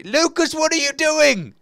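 high, loud and slow exasperated call; exasperated shout. Real, not acted. Gamer yelling at Lucas for taking his stuff, exasperated. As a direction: call out to your friend in disbelief.

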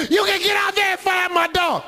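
fast, high yelling; high raging woman; furious shout. Retold or imitated. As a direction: scream it over him.

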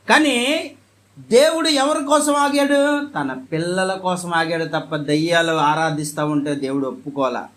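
ordinary delivery

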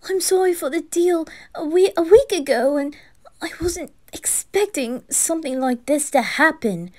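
high, flustered apology; worked-up, high-pitched begging; anxious pleading. Acted. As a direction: plead nervously for forgiveness. Pleading not to be thrown out.

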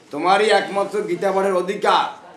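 high, ringing declaration; loud proclamation. Retold or imitated. Mahaprabhu's verdict to the Brahmin, loudly proclaimed.

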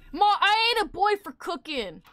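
high, loud whining protest; indignant kid. Pretend. Boy refusing to cook for Ma, indignant. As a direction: protest to ma like a bratty southern boy.

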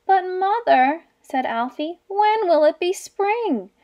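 small bear nags his mother, high and pleading; pleading cub. Acted.

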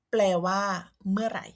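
ordinary talk

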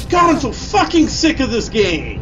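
high, loud shouts of frustration; angry outburst. Real, not acted. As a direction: yell at the game, fed up. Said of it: "I'm getting very angry".

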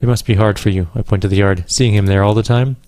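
ordinary talk

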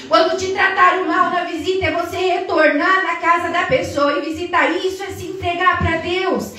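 fiery sermon voice; full-force preaching. Acted. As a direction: preach it hard, building to a shout. Preacher builds to shouting.

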